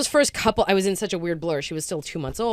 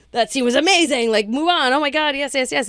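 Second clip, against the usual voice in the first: high, loud, gushing praise; imitating the crew raving over a scene; excited praise; 9 semitones higher, 8 dB louder than usual. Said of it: "everybody's like".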